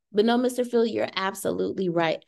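normal talking voice

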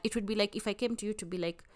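normal talking voice

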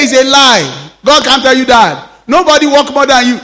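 a friend shouting back in disbelief; retold shout. Friend shouts at his pastor friend in protest, loud. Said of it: "he shouted at him".

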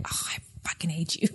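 a hushed whispered curse; whispered insult. Retold or imitated. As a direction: whisper the insult under your breath. Husband's retort in quarrel retold.

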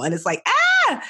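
a sudden high cry of frustration; frustrated ah. Real, not acted. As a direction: let out a sharp, exasperated ah. Frustrated outburst at herself.

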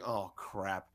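low, hushed mutter to himself; sinking dread under the breath. Retold or imitated. Reporter realizing he must interview the coach, muttered dread.

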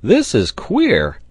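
loud, high, drawn-out exclamation; astonished cry. A narrator's reading. Sailor baffled by the falling ladder, crying out. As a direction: cry out slowly in puzzlement. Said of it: "cried the sailor".

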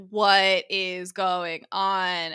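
very slow, drawn-out disbelief; drugged bewilderment. Retold or imitated. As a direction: stretch every word out, dazed from the drugs. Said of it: "coming down off all these drugs".

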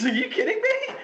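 high-pitched mock disbelief; laughing, incredulous protest. Incredulous at someone hogging the space.

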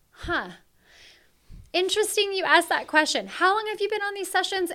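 high, loud put-on surprised voice; mock surprise. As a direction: act amazed at a question from a veteran trooper. Mimicking a long-time member's question.

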